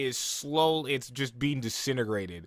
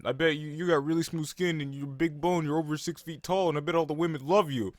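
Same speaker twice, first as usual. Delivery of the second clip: a hint of a slow, irritated demon grumble; 3 semitones higher than usual. Demon Gutaro sneering at Uzui, lightly irritated. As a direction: sneer it out like a jealous brute. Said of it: "slow and real irritated".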